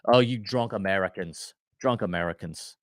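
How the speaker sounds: put-on a quick, half-hearted British accent sneering at the fans; mock British accent. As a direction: lightly play a grumpy Englishman with a British accent. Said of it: "I can't do my British accent".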